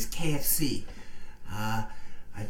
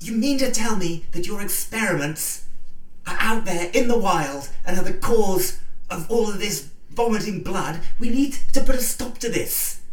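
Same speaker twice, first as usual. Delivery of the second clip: dramatic outrage; 6 semitones higher, 6 dB louder than usual. Acted. Dramatic confrontation.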